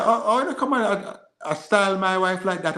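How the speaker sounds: high-pitched Jamaican patois protest; retold patois outburst, pitched well up. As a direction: break into indignant Jamaican patois. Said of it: "And I started the, the pato".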